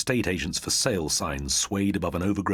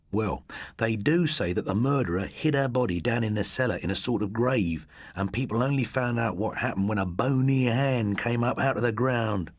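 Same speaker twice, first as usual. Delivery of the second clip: higher voice, building the horror; spooky storyteller boy; 6 semitones higher than usual. A narrator's reading. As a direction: tell it to give them shivers. Christopher continues ghost tale.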